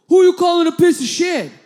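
very loud, high challenge; full-volume mock fury; acted angry shout. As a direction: play an enraged driver squaring up to fight. Driver returns with fists clenched.

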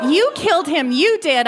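high, loud accusing scream; screaming accusation. A narrator's reading. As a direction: hurl it at her as they drag you off. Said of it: "I screamed".

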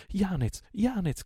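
high, loud frightened call; young woman calling out in alarm; crying out. A narrator's reading. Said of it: "gridò la giovanetta". (grido acuto e allarmato)

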